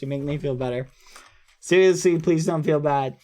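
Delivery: normal talking voice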